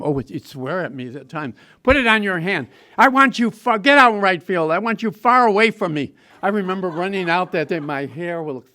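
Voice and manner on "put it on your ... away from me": loud, impatient coach voice; loud, irritated coach barking orders at a kid; gruff scolding. Retold or imitated. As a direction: order the dummy around, loud and annoyed. Coach sending him to right field.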